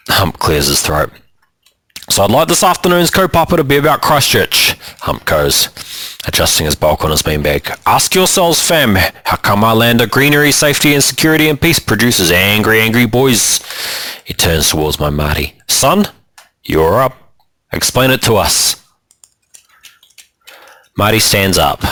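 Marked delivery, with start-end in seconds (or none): 2.1-4.7 higher, lightly voiced group leader; Hump leading. A narrator's reading. Hump opening the afternoon session, lightly voiced leader. 7.8-18.7 higher, lightly voiced group leader; Hump leading. A narrator's reading. Hump opening the afternoon session, lightly voiced leader.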